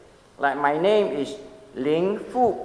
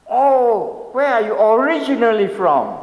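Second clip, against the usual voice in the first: pressing questioner; 6 semitones higher, 5 dB louder than usual. Retold or imitated. Asker presses further for real origin.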